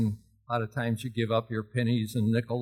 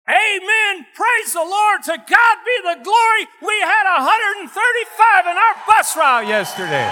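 full-force, very high shout of praise; hollering; 10 dB louder than usual. Retold or imitated. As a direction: holler it out like a fired-up revival shout. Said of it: "I just hollered out".

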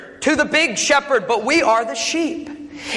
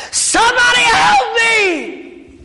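slow, drawn-out scream, much higher and louder; desperate shout; 12 semitones higher, 5 dB louder, much slower than usual. Acted. Man trapped by lion screams to reptile keeper, all-out slow yell. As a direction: throw everything into one last yell for help.

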